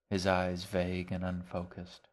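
ordinary delivery

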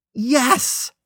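loud, very high-pitched yell; shouted. A narrator's reading. Narrator answers his meddling friend, shouting. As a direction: yell it out in exasperation. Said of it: "I shouted".

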